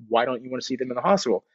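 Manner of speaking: normal talking voice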